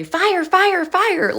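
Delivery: high, squawky bird alarm; parrot voice. Retold or imitated. Bird taught to shout fire, high squawk. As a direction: chant it like a parrot, breaking into a laugh. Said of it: "taught him how to say".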